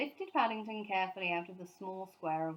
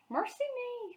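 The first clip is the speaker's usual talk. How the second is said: much higher shocked gasp; startled cry. A narrator's reading. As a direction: cry out in alarm. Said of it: "cried Missus Bird".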